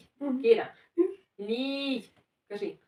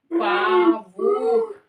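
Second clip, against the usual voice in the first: loud demo; 2 semitones higher than usual. Shown as a demonstration. Teacher shows the child how to say it, loud and drawn out. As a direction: sound it out at full voice. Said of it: "А громко надо казати".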